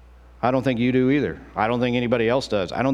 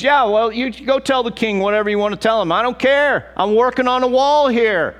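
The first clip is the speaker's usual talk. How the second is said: much higher, loud defiant declaration; defiant shout; 10 semitones higher, 5 dB louder than usual. Retold or imitated. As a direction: shout down the threats, unbothered. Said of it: "He's a guy that goes".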